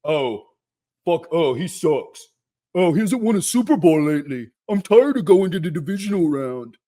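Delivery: put-on griping fan voice; mock complaining fan. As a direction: parody a fed-up fan's moaning. Mimicking complaining fans.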